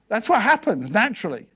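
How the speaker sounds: ordinary talk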